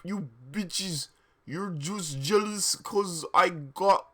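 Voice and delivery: drawn-out, cocky fanfic author; mock author's note voice. Author telling off jealous haters in a note, mock-cocky. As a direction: read it as a sassy author, stretching the words.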